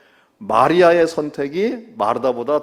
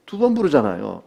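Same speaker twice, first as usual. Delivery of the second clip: ordinary delivery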